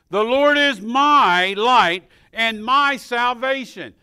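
loud, high, slow proclamation; preaching a verse. Acted. Preacher reads Psalm 27 to the church, loud and slow. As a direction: draw every word out.

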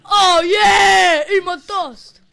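high, very loud victory shouts; real battle cries; triumphant yelling. Real, not acted. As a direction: roar it like you've won. Shouting while winning a fight.

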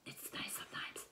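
soft unvoiced aside; breathy little secret; whisper. Real, not acted. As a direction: drop to a whisper for a moment. Aside to camera.

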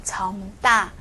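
정大 as 점따, holding the first syllable; long first syllable. Shown as a demonstration. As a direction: stretch 정 to an m before a tense 따 in 정大. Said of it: "要读的长一点". (정大的第一个音拖长)

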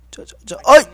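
loud high cry of failure; frustrated yell. Real, not acted. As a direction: yell as it fails. Tense jump attempt.

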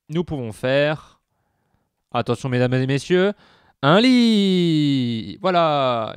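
mock announcer. Player grandly unveiling a bed to viewers like an announcer. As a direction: present it like a circus ringmaster.

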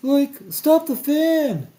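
high, loud, drawn-out cartoon yell; Shaggy shouting. A narrator's reading. Shaggy to Fred as snacks fly away, shouting. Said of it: "shouted Shaggy".

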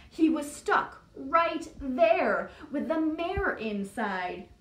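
ordinary delivery, nothing marked

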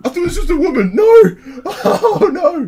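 high, loud squealing laughter; shocked laughing squeal. Real, not acted. Prankster laughs high at the wrong recording. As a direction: shriek in laughing disbelief.

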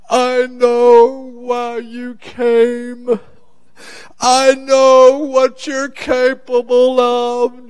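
high, drawn-out, chanted plea; lone crying out. Acted. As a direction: call slowly across a silent crowd, stretching every word. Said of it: "he's the only voice now".

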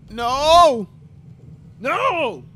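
loud high repeated no; frustrated yell. Real, not acted. Gamer yelling as his character dies again, frustrated. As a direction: cry out in despair.

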